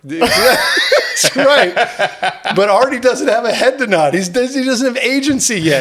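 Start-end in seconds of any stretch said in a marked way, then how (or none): 0.0-4.1 laughing. Real, not acted. Laughing at own joke.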